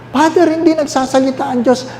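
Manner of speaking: high, whining complaint to Father; the churchgoer protesting that God is silent; voiced complaining parishioner. Parishioner complaining to the priest, high and whiny.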